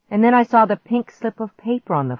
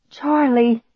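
high, louder cry of relief; relieved cry; 7 semitones higher, 5 dB louder than usual. A narrator's reading. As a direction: call out the name, hugely relieved. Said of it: "I cried out with relief".

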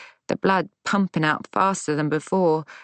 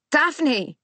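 high urgent call over the shoulder; shouted; 10 semitones higher than usual. A narrator's reading. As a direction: call her from across the lawn, higher. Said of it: "I shouted behind me".

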